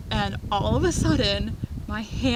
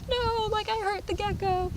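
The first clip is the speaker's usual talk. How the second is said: distressed insistence; 12 semitones higher than usual. Retold or imitated. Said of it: "I'm like".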